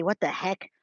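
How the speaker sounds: normal talking voice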